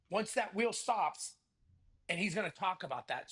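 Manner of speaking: usual speaking voice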